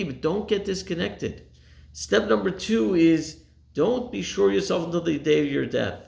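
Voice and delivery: ordinary talk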